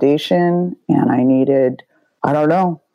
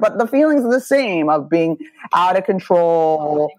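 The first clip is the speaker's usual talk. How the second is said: normal talking voice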